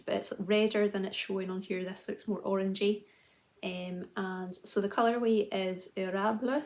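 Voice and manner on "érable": lightly Frenchified word; dodgy French accent. Shown as a demonstration. Knitter names the colourway, dodgy French accent. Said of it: "Again, with the dodgy French accent".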